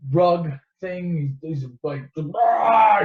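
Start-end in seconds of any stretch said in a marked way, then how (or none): 2.2-3.0 loud mock Wookiee growl. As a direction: roar it like Chewbacca. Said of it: "He growls".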